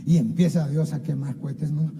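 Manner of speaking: usual speaking voice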